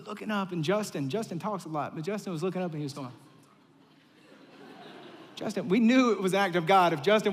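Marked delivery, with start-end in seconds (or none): none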